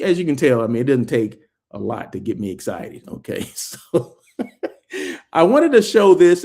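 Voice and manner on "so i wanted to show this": laughing through the words, higher and louder. Real, not acted. Vlogger laughing at himself while touring hotel to viewers, laughing. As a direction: chuckle as you start showing.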